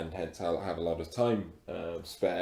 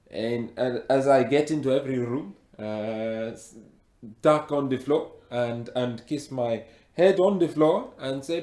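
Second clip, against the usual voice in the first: put-on heavy African accent; 3 dB louder than usual. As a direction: speak with a thick African accent. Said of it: "you've got a very thick accent".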